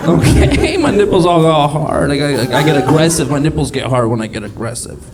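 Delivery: usual speaking voice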